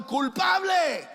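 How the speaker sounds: drawn-out, high shouted verdict; mock crowd shout. The crowd condemns Jesus, a slow high shout.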